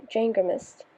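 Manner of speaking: plain reading voice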